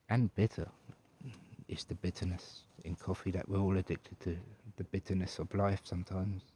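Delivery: posh English butler, lingering over words; Grim. Pretend. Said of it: "particularly posh English".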